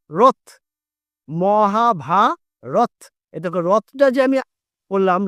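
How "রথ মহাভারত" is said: very slow, each syllable stretched long; drawn-out syllables. Shown as a demonstration. Teacher stretching Mahabharata for students, very slow. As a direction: pull every syllable of Mahabharata out slowly. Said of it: "আমি টানলাম".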